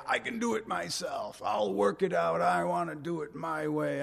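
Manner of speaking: lightly voiced stubborn youngster; a touch of a kid insisting on their own way; mock defiant kid. A parent voicing kids who refuse help. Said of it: "the next generation seems to say".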